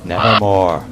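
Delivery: low, loud, ominous croak; raven's reply. Acted. The raven answers the speaker, low and ominous. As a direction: drop deep and toll the word like a bell. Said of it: "Quoth the raven".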